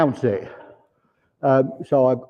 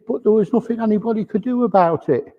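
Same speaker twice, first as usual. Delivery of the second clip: higher, teary voice, mildly choked; a touch of tears in a higher voice; tearful; 8 semitones higher, faster than usual. Retold or imitated. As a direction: voice the man's regret as if near tears. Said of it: "he's got tears in his eyes".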